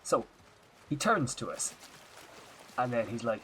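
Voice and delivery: story told in a light put-on Irish accent. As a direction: chat about the trip in a mild Irish lilt. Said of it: "we're definitely going Irish".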